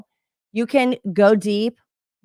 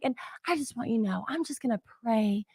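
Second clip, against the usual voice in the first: very soft, imitated sweet phone rep; sugar-sweet sympathy; 9 dB quieter than usual. Support rep offering prayer to customer, soft sweet sympathy. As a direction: gently hush it like a kindly support lady. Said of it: "She was actually so sugar plum sweet".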